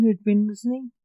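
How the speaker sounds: ordinary delivery, nothing marked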